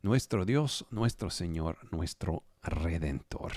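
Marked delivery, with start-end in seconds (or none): none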